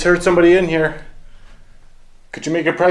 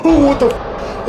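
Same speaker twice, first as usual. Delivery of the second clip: much higher, slow startled cry; frightened shock; 10 semitones higher, 3 dB louder than usual. Real, not acted. Wheelchair rolls at him, high slow scared cry. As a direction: recoil as something comes at you.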